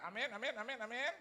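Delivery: high, loud string of amens. Retold or imitated. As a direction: fire off amens, voice lifting each time. Hurried amens while dodging handshakes.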